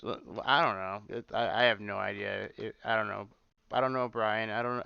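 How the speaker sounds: normal talking voice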